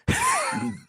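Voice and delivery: short embarrassed laugh; real laugh at own mistake. Real, not acted. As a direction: burst out laughing after the flub. Laughs after flubbing the line.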